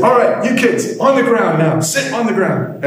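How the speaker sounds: high, commanding principal voice; stern principal order. Retold or imitated. Principal ordering the ten-year-olds to sit, commanding.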